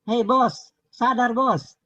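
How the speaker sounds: snapping him back to reality. Real, not acted.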